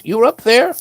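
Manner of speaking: high incredulous question; retold astonishment. Local hears where the couple slept, high-pitched disbelief.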